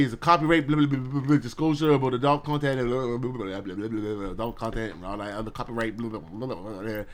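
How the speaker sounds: fast mumbled disclaimer read; speed mode. Shown as a demonstration. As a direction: rattle off the legal text in a rush. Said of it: "I said it in speed mode".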